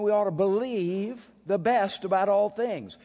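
usual speaking voice

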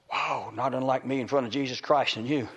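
ordinary talk

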